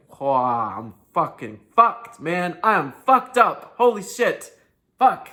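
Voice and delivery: a horrified discovery that builds to a yell; swearing in rising disbelief; shocked realization. Retold or imitated. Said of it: "for like".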